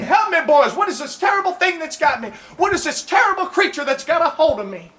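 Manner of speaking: high, frantic cries for help; mock panicked scream. Texan bitten by a snapping turtle, hammed-up high panic. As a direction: yelp it like a turtle's got your foot. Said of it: "crying and hollering and screaming".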